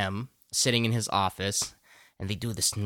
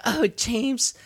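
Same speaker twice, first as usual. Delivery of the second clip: mock swooning woman; 10 semitones higher, slower than usual. Voicing the Bond girl.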